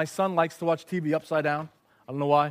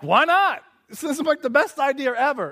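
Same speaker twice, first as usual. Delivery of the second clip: excited protest; 10 semitones higher, 5 dB louder than usual. Retold or imitated. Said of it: "we're like".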